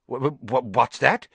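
stammering in shock; stunned, stammering question. Acted. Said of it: "in a stunned voice".

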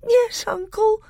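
put-on a small boy answering through tears; sobbing. A narrator's reading. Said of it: "sobbed Caspian".

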